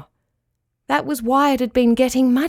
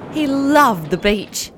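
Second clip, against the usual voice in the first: ordinary delivery, nothing marked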